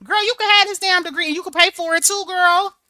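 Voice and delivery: high, loud, fast outburst; incredulous high-pitched rant; indignant. Real, not acted.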